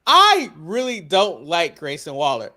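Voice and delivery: ordinary talk, nothing marked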